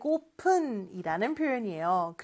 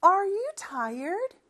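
slow model reading; 7 semitones higher, much slower than usual. Shown as a demonstration. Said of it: "이렇게 발음합니다".

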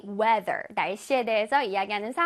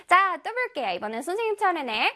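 ordinary delivery

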